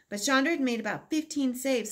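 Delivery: plain reading voice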